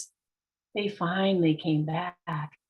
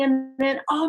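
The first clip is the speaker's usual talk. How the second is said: ordinary talk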